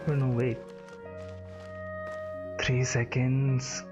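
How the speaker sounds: normal talking voice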